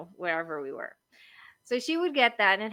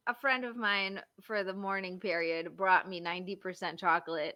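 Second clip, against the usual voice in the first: normal talking voice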